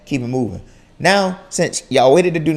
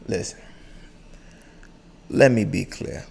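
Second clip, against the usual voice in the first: lowered, deliberate father warning; dad's low warning voice; 4 semitones lower, 7 dB quieter than usual. Retold or imitated. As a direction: drop the voice like a dad who means business. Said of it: "They, they lower their voice and clear their throat".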